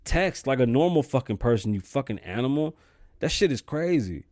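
normal talking voice

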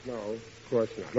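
sheepish backing down. Acted. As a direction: back off meekly. Wife scolds his murder threat.

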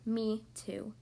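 lightly voiced small echo; low little chime-in from the pouch; young kangaroo. A narrator's reading. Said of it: "the young kangaroo in her pouch said".

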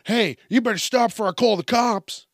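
high-pitched busybody threat; mock scolding bystander. Host as bystander threatening fist fighters, mock scolding. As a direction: pipe up with a high mock warning. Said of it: "like me going".

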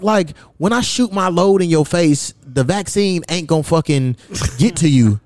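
ordinary talk, nothing marked

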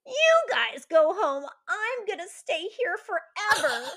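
slow, high, loud fake sob of a little girl; mock crying child. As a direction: wail it like a dramatic kid flung on the bed. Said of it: "starts crying".

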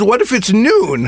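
high, slow eager question; asking like a puzzled child; excited kid rambling. Retold or imitated. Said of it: "Well, she says".

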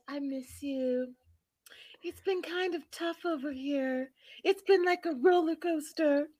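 high, soft mock-pitiful voice; Debbie impression. Acting out a mother missing her son, high soft plaintive voice. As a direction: keep it high, small and plaintive. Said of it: "she's like".

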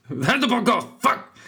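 laughing, high-pitched shouted sign-off; shouting. Shown as a demonstration. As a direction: shout it high, then swear. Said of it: "I know I'm shouting".

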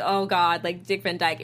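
dismissive groan. Retold or imitated. Panelist contrasting a cringing reaction with an endearing one, dismissive. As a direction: play a let-down "oh God" reaction. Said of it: "rather than being like".

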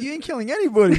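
incredulous retort. Retold or imitated. Retelling his reply to her threat.

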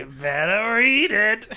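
high, slow, teasing slyness; hammed-up sly insinuation; exaggerated sly. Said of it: "You said that very slyly".

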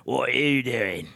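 put-on slow, drawn-out English accent; mock English accent, stretched vowels; old English accent. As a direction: challenge them like a nosy old English local. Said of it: "They do the accent".

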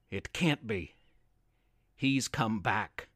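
a frightened murmur; fearful. A narrator's reading. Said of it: "now grew quite fearful".

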